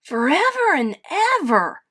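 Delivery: high, loud little bear shout; exuberant, high-pitched cry. A narrator's reading. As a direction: shout it joyfully like a child. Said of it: "shouted Little Bear".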